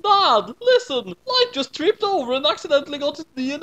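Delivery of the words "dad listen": plain reading voice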